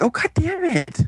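high-pitched, exasperated whine; mock frustrated. Storyteller acting out the fooled characters, high mock frustration. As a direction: imitate them fuming in a high voice.

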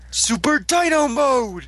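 high, drawn-out hero call; hammed-up superhero battle cry; mock power-up call. Hammed-up call of attack name.

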